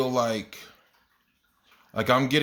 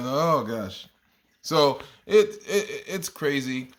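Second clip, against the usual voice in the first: ordinary talk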